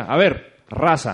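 loud, bossy call to the crew; aggressive rallying call. Retold or imitated. Organiser calling his group to attention, aggressively. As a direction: round up the group with force, re-enacted. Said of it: "Uy, qué agresivo".

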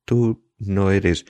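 slow, high, broken words; halting through tears. A narrator's reading. Evelyn cries across the table, broken and halting. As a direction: let it break off mid-cry. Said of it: "se interrumpe secándose la cara".

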